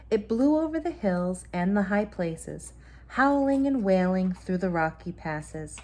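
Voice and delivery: story narration. A narrator's reading. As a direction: tell it like a bedtime story to a child. Mother reads bedtime story.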